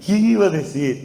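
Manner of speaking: retold overwhelmed cry; crying and laughing. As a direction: marvel through mock tears. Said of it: "lloraba y se reía al mismo tiempo".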